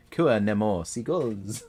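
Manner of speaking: slow, hammed-up Spanish accent, each word stretched; a deliberately awful Spanish accent, played for laughs; terrible Spanish accent. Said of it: "I read that in a Spaniard's kind of accent".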